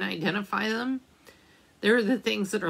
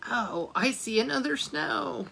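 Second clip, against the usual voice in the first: plain reading voice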